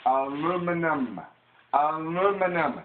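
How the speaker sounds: "Aluminum" loud and drawn out, uh-LOO-mi-num; "Aluminum" in four stretched syllables, stressed on LOO; American pronunciation. Shown as a demonstration. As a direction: slowly holler "Aluminum" as a correction. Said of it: "Learn how to pronounce it".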